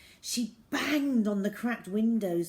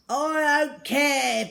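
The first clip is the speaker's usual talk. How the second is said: loud, slow angry bark; gruff landlord barking at Zoe; 8 semitones higher, 11 dB louder, slower than usual. A narrator's reading. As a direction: snap it at her, slow and loud. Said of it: "barked the landlord".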